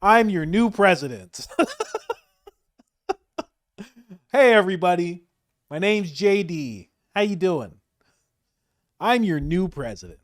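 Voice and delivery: a hint of a salesman president greeting a crowd; mock JD Vance. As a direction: JD Vance introducing himself to a Russian crowd, breezy. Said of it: "being like".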